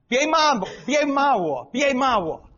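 high, loud Chinese protest; imitated scolding. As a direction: act out someone protesting a scolding. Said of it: "scold somebody in a nasty way".

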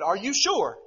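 loud, high acted disbelief; imitated astonished double-check; incredulous question. Retold doubting husband.

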